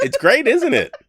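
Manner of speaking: high, loud laughing question. Real, not acted. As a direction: laugh through the words. Laughing over his video effect.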